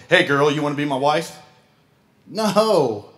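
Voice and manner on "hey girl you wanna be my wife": nonchalant. Retold or imitated. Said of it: "I didn't nonchalantly go".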